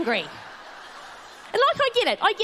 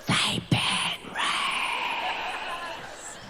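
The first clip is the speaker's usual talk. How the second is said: a very slow, breathless whisper; awed whisper; 4 semitones higher, 7 dB quieter, much slower than usual. Acted. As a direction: whisper the words like a sacred secret. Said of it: "I blew my own mind".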